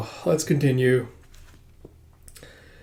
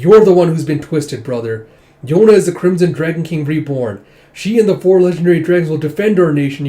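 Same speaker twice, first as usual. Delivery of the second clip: louder, higher accusation; accusing; 4 semitones higher, 7 dB louder than usual. Retold or imitated. King accusing his brother in a loud heated quarrel. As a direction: throw it at your brother.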